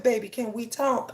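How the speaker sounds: soft, gentle plea; calm and sweet. Retold or imitated. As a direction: sweeten it for the husband. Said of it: "calm and cool and collective".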